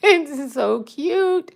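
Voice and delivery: slow, laughing coo. Real, not acted. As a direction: giggle through the words. Cuddling purring cat.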